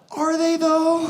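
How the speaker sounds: high, doubting retort; skeptical inner voice. Acted. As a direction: scoff at the slogan in your head. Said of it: "And I thought".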